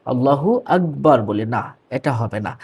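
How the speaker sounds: ordinary talk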